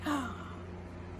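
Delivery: breathless, quiet gasp of amazement; soft gasp. Real, not acted. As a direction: catch your breath at seeing family. Seeing her family.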